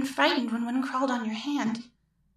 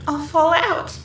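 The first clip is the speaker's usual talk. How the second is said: higher and louder, fear building to panic; rising fear; 6 semitones higher, 3 dB louder than usual. Acted. Woman in a dream airplane terrified of falling, panicking. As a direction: let the dread climb to a near wail.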